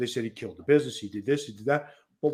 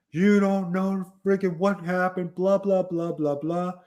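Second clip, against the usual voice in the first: higher, louder imitated rant; retold rant that fizzles into blah blah; mad ranting friend; 6 semitones higher, 4 dB louder than usual. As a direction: play the furious friend venting. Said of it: "He's cursing".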